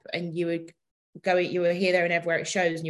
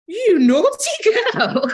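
mock scolding tease; 13 semitones higher, 9 dB louder, much slower than usual. Teasing message retold.

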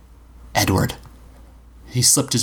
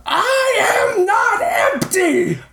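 high, slow, furious screaming cry; curdling scream; much slower than usual. A narrator's reading. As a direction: tear the words out in rage. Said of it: "a curdling scream".

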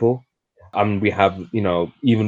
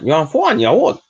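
imitating a thick Geordie accent, pitched up; 7 semitones higher, 5 dB louder than usual. Cafe girl asks a customer, in mock Geordie accent. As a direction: mimic the Geordie cafe girl, a bit higher. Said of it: "in a thick Geordie accent".

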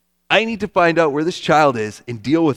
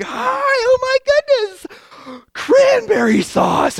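very high, slow gushing TV host; mock a fake-cheery morning show presenter; morning news host; 4 dB louder, slower than usual. Morning show host gushing over cranberry sauce, high and slow. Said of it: "Everybody's like".

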